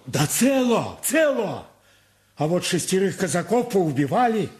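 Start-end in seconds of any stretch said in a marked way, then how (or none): 0.1-1.7 urgent shout. Acted. Servant hurriedly reassuring master.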